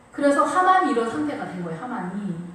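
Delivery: ordinary talk, nothing marked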